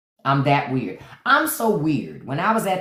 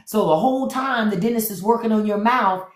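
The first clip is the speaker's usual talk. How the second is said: ordinary talk, nothing marked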